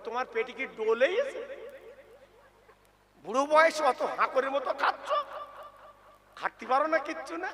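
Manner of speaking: scornful scolding through laughter; mocking daughter-in-law, laughing. Preacher mimics a daughter-in-law scolding her father-in-law, laughing. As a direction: sneer at the old man while chuckling. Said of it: "দেখেছেন কিরম সবক দিচ্ছে".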